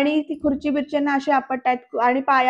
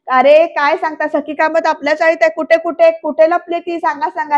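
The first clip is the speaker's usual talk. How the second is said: loud retold crowd clamour; excited shouting; 3 semitones higher, 6 dB louder than usual. Chawl residents demand where Sakhi is hiding, shouting. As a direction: shout eager questions over one another. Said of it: "असे ते चाळकरी ओरडतात".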